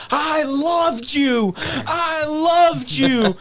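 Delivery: put-on high, slow, wailing kid sobbing; crying kid. Kid in a Tigger costume wailing at the heel, high and slow. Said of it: "I'm arguing with people".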